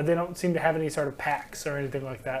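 usual speaking voice

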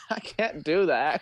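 ordinary talk

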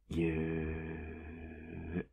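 very long, steady held vowel; drawn-out squeeze. Acted. Boyfriend hugging stubborn partner, long drawn-out squeeze. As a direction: hold it for as long as the hug.

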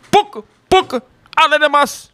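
very high mock chatter; comic high-pitched babble; squeaky gibberish. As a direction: squeal the nonsense. Nonsense in squeaky voice.

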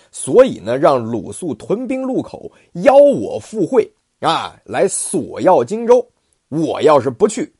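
高亢洪亮、放慢的武将口吻; 像关公一样傲气十足地拍板; 关二爷傲然. Acted. 关羽拒劝要单刀赴会. (关羽对儿子关平豪言，高亢傲然)

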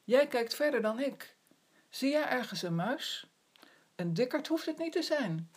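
calling upward. Acted. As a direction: keep calling up to the bird. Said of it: "roept Zwartje naar boven".

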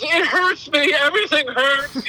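high-pitched imitation of a hurting child; mock kid yell. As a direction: wail it like a kid, without extra volume. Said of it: "my kid yells".

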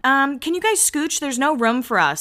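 a hint of a put-out Lauren; voicing Lauren. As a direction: play it lightly snooty. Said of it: "she's like".

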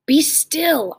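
begging. Acted. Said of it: "I begged".

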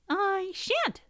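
very high, small refusal; squeaky mouse. A narrator's reading. Mouse refusing to make breakfast, squeaky. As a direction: squeak a sulky no.

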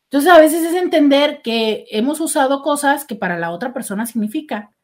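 ordinary talk

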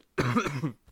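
coughing hard; a real fit of coughs. Real, not acted. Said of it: "Désolé, je tousse un peu".

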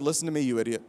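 low, hushed warning to a friend; whispered. Retold or imitated. Pastor telling his friend on the bus, quiet warning. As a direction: hiss it under your breath. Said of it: "and I whispered".

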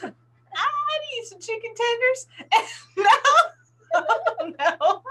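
high caricature breaking into laughter; Candace. Acted. Ordering chicken tenders as co-host, high laughing impression. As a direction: pitch it way up, then crack up. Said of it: "I'm Candace".

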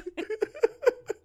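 very high squeal of laughter. Real, not acted. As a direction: shriek with laughter. Laughing at Jughead.

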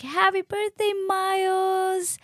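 very high, drawn-out celebratory call; imitated delighted birthday greeting; cheering staff. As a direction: sing it out like a cheering crowd. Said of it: "they're all like".

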